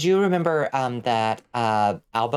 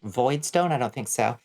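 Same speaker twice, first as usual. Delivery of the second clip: ordinary talk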